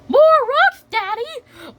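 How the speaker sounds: very high little character voice; Peppa Pig. Retold or imitated. Peppa questions her daddy about rocks, very high voice.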